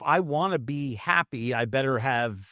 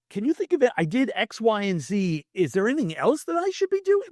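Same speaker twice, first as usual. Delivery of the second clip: much higher, quick earnest question; calmed roommate asking; 7 semitones higher than usual. Retold or imitated. Calmed roommate asking the friend what else to do. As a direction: ask for help, genuinely open now.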